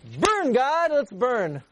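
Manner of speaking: Burn, God pitched high and eager; eager plea. Retold or imitated. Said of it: "So Avraham Avinu said".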